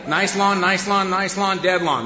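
ordinary talk

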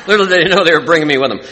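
high, loud, fast punchline through laughter; amused, laughing. Real, not acted. Speaker joking that parents brought the trouble along, laughing. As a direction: laugh while landing the joke. Joking about escaping prison by moving.

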